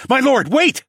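high, loud appeal; urgent plea. A narrator's reading. Pleading with an enraged lord, an urgent shout. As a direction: call out to halt an attack.

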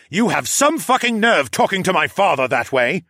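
furious yell defending his father. A narrator's reading. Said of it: "the douche yelled".

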